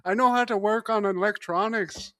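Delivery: badly faked Irish job seeker; Irish accent. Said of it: "I could show up and be like".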